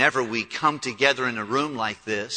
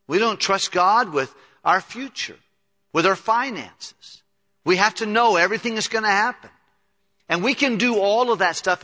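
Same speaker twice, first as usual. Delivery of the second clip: raised, loud sermon climax; preaching at full force; 6 semitones higher, 4 dB louder than usual. Acted. Pastor urges his church to trust God, preaching hard. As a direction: drive the point home from the pulpit.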